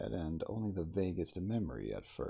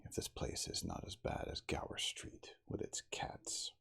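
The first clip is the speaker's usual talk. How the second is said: whispered oath, barely voiced; sworn under breath; 8 dB quieter than usual. A narrator's reading. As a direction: swear to yourself in a hushed whisper. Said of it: "swore under his breath".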